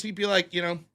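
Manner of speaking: normal talking voice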